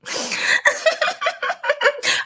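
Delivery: very high, airy put-on laugh; a soaring diva giggle; new laugh. Trying out a new laugh for her viewers, very high. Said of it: "that was gonna be my new laugh".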